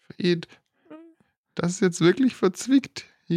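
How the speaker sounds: high, worried windrider voice; high character voice. Acted. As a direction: fret in a high voice.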